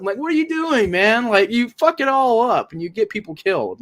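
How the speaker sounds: disbelieving complaint at Peter; exasperated. Real, not acted. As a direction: throw up your hands at his choices. Exasperated rant at the character.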